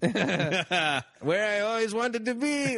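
put-on slow, high laughing Mexican accent. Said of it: "Is that Mexican accent?".

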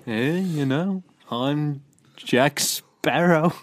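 slow, put-on Liverpool pirate. Co-host joining the joke, Beatle-like Liverpool accent. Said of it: "sounding like a beetle".

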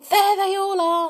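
high, loud excited cry; bursting with excitement. Acted. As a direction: shout it out with delight. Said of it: "said Anne excitedly".

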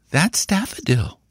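put-on high child's voice; small boy sure of his tip, pitched high; high-voiced little nephew; certain young boy. A narrator's reading.

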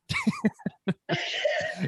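squealing high laugh. Real, not acted. Fan laughing about nearly passing out, high laugh. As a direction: burst out laughing at herself. Laughing at her own panic.

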